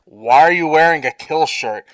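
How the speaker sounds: loud, puzzled guard voice; blunt parody guard; mock soldier. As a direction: play a suspicious, dim soldier. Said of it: "the soldier's like".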